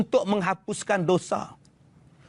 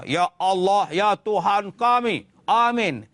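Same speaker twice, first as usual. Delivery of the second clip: firm, slowed demanding prayer; rough praying; slower than usual. Shown as a demonstration. Preacher shows the wrong way to pray, rough and blunt. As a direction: pray bluntly, without any pleading. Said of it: "Jangan guna kasar".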